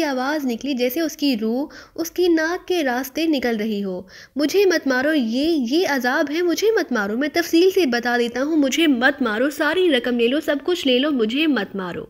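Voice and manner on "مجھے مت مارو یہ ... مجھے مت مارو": ordinary delivery, nothing marked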